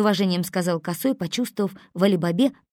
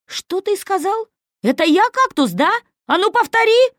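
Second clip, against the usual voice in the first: outraged; 14 semitones higher, 9 dB louder than usual. A narrator's reading. Али-Баба offended.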